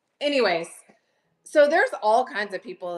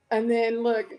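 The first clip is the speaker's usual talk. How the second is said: usual speaking voice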